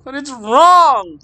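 put-on slow, high, loud tearful girl. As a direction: say it through tears. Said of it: "she declared, tears running down".

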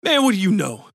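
venomous. A narrator's reading. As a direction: spit it out with venom. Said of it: "his voice suddenly venomous".

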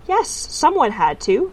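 put-on a child defending her kindness, heated; hotly. A narrator's reading. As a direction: fire it back, indignant. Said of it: "said Tiffany hotly".

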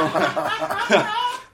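high loud burst of laughter; can't help cracking up; laughing. Real, not acted. Laughing at the grave.

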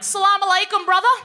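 high, loud eager welcome; eager greeting. Retold or imitated. Dad greeting the Aussie neighbour, eagerly friendly. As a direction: greet him like a long-lost brother. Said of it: "He'd be like".

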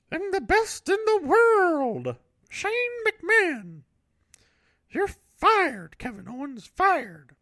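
a pompous squeaky boss firing his referee; Shane McMahon voice. Retold or imitated. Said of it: "that's my new Shane McMahon voice".

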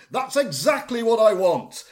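high, emphatic delight; hammed-up moment of discovery; exclaiming. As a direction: declare it theatrically. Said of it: "he exclaims".

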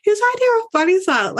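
high, fast rhetorical question; incredulous comeback. Retold or imitated. Witness retorting to being called a liar, high-pitched incredulity. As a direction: retell it with rushed, high-pitched disbelief. Retold indignant comeback in court.

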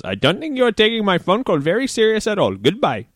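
high-pitched, indignant Indian scammer impression; mock Indian accent, serious. Scammer ending the phone call, mock-serious Indian accent. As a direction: play the affronted caller in an Indian accent. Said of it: "then got all serious".

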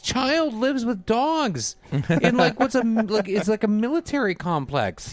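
high, exasperated disbelief; baffled parent ranting; incredulous rant. Retold or imitated. Bewildered rant about Paw Patrol.

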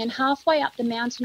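usual speaking voice

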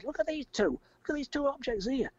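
high urgent mock yell; retold scream. Man urging mate to see flying objects, high mock yell. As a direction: point to the sky and shout, pitched high. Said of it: "I'm screaming and I'm pointing".

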